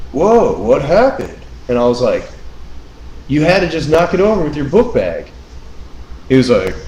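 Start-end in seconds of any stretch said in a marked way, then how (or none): 0.1-1.4 mock surprised, higher drawn-out exclamation; retold surprise. Retold clueless surprise at spill. 3.3-5.3 higher-pitched indignant retort; retold accusation. Retold accusation over spilled drink.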